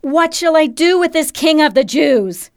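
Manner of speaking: shouted question. A narrator's reading. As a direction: call it out over a crowd. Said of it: "shouted to us".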